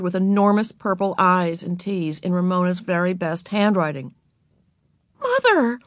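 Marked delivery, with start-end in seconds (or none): 5.2-5.8 put-on high-pitched girl's cry for attention. A narrator's reading. Beezus calling Mother to see the damage, high cry. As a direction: call out in a high, upset child's voice. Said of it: "cried Beezus".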